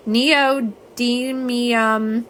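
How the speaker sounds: neodymium said very slowly, nee-oh-DIM-ee-um; slow syllable-by-syllable neodymium. Shown as a demonstration. Host sounding out the magnet word for her viewers, very slow. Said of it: "This is how I learned how to say it".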